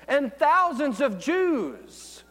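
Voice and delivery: rising pulpit fervour; building preaching. Acted. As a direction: swell toward the climax. Preaching Peter's first sermon.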